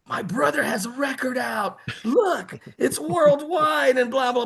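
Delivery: high, proud bragging voice; bragging sister. Retold or imitated. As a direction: show off the record, voice up high. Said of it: "She's bragging".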